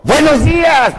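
high-pitched mock yell; shouted greeting. Man greeting a packed elevator by surprise, shouting. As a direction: burst out with a hammed-up, high greeting. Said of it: "un güey que entra gritando".

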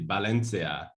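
"Valencia" starting with a B sound; Spanish V as B. Shown as a demonstration. Explaining Spanish to his friend, "Valencia" with V as B. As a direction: say "Valencia" as Balencia. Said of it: "V's in Spanish are pronounced like B".

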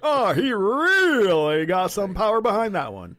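slow high color commentary; baseball commentator. Pretend. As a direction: savor it like a ballpark announcer.

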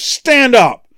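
high, loud commanding call; acted ringing command. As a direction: call out as Paul ordering a man up. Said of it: "calls out in a loud voice".

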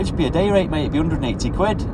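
high put-on tradesman voice; plasterer's casual quote. Plasterer pitching his day rate to barber, high matey voice.

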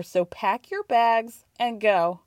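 ordinary delivery

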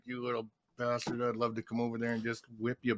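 low, soft threat; retold muted threat. Coach eyes timekeeper from centre ice, quietly threatening. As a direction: threat said under the breath.

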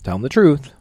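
firm retold command from dad; imitated dad. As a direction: do a dad giving instructions. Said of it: "my dad was there, and he goes".

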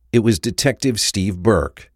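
usual speaking voice

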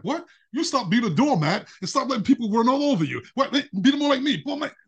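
high, exasperated mock arguing; indignant retort. Husband retelling his comeback to his wife in a quarrel, indignant.

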